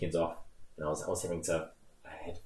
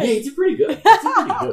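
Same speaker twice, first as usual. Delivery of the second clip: high, loud laughing squeal; real laughter; 14 dB louder than usual. Real, not acted. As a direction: squeeze the words out through laughter. Laughing while judging Andy's accent.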